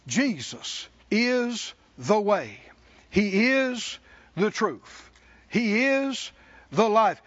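slow, weighty preaching; measured pulpit proclamation; slow declaration. Acted. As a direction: lay each phrase down slowly.

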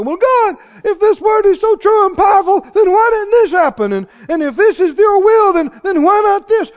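high, loud complaint to God; accusing God with sarcasm; disrespectful whine. Retold or imitated. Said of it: "What does that tone show? Disrespect".

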